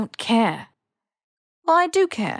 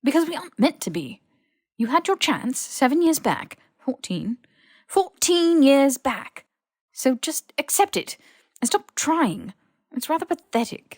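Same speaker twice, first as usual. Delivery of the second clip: higher and louder, bitter; heated rejection; 7 semitones higher, 4 dB louder than usual. Acted. Malfoy angrily telling Harry to give up, heated. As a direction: lash out, voice climbing.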